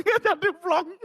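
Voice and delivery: high, laughing answer. Real, not acted. Pastor laughing with the congregation, high laughing reply.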